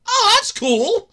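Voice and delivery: high, loud delighted exclaim; light up at the news; excited. Real, not acted. Fan delighted by singer news, high excited exclaim.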